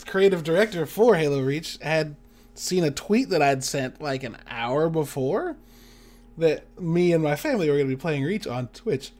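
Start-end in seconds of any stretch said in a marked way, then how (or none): none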